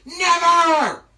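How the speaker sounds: high, very loud, drawn-out refusal; defiant shout. Acted. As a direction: bellow your refusal at the top of your voice. Loki defies the eagle.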